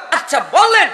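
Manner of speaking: high, loud demand; acted forceful prompting; shouted challenge. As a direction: press the listener sharply for an answer.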